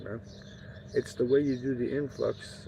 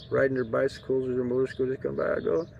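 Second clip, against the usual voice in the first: usual speaking voice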